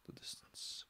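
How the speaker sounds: eerie hushed whisper; whispered echo. Acted. Reader echoing the article's line, whispered echo. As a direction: whisper it, trailing into nothing.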